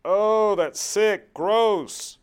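high, loud shriek of disgust. Retold or imitated. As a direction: recoil in loud revulsion. Disgusted reaction to sex ads.

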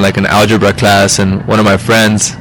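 normal talking voice

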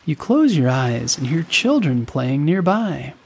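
ordinary delivery, nothing marked

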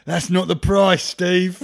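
loud, high, laughing shout; hammed-up crooked official shouting Steve down; mock official refusing. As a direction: bark the refusal while cracking up. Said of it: "It's like".

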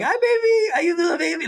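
put-on very high cooing baby voice; baby talk. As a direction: coo like to a baby. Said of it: "it's just basically like".

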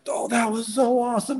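high, gushing imitation of thrilled fans; elated quote. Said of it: "they're so ex-, elated".

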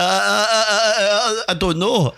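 high, mock-panicked stuttering; flustered stammer. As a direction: play a youngster whose mind has gone blank. Young player thrown off his thoughts.

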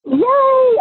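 a high, delighted cheer of greeting; excited yay. Real, not acted. Guest greets the podcast host, a high delighted cheer. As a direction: squeal your joy at being welcomed.

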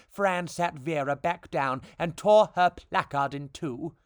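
ordinary delivery

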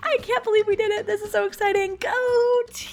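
celebrating, over the moon; giddy relief. Real, not acted. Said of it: "I'm celebrating".

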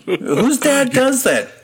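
slow, very high incredulous wail; high-pitched comic indignation; laughing outrage. Real, not acted. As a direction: draw out the disbelief, laughing. Indignant laughing question about dad.

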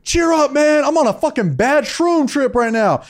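hammed-up tripping guy yelling; yelling heckler. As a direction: shout it across a small room. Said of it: "He's like".

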